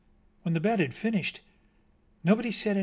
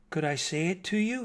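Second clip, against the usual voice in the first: timid higher-pitched request; timid, almost guilty; 5 semitones higher than usual. A narrator's reading. Bat asking mockingbird to hear his poem, timid. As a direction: ask shyly, as if a little guilty. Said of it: "He sounded timid, guilty almost".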